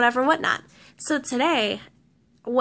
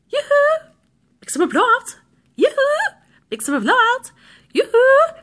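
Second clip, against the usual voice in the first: annoying alarm voice; 11 semitones higher, 4 dB louder than usual. Retold or imitated. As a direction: mimic a chirpy salesman on repeat. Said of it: "the guy's voice is really, really annoying".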